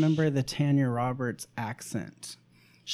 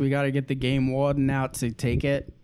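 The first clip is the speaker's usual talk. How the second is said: half-working Southern twang; Southern accent, not quite landing; attempted Southern accent; 3 semitones lower, 3 dB louder than usual. Shown as a demonstration. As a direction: try for a Louisiana Southern accent. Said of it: "I can't do it".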